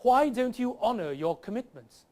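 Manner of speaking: formal Queen's English style accent; perfect English accent. Shown as a demonstration. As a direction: pose it in an impeccable grammatical English accent. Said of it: "between perfect grammatical English".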